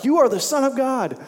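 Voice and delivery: high-pitched mock yell from the crowd. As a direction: call it out like someone in a crowd. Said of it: "someone in the crowd yells out".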